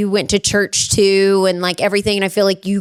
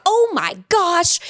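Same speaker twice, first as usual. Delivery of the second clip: high, drawn-out amazement; slow, raised-pitch reenacted disbelief; retold shock; 8 semitones higher, slower than usual. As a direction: stretch it out in mock astonishment. Retells shock at secret boyfriend.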